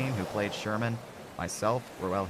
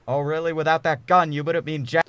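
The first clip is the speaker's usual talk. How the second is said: higher, louder defiant jeer; angry taunt; 8 semitones higher, 9 dB louder than usual. Acted. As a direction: throw it in the gunman's face. Stu taunts armed captor.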